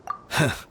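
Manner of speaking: a short, breathy, rueful chuckle. Acted. Bitter laugh before confession.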